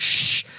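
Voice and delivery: long "shh"; firm drawn-out shush; imitated shushing. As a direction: hush someone who is screaming. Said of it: "And they're like".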